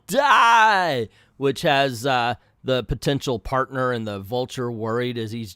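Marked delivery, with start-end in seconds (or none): 0.1-1.1 loud, high drawn-out die; retold yell. Lupex finishing off Death's Head, loud long mock shout. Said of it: "and yell".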